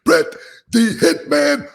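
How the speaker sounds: loud, high, drawn-out ring announcer intro; Howard Finkel impression. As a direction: boom it out like a big arena announcer. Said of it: "my Howard Finkel impersonation".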